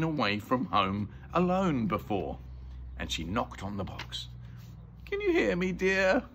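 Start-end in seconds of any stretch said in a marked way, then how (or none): 5.0-6.2 high-pitched motherly call; much higher calling voice. Acted. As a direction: call anxiously to someone shut away. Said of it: "she called".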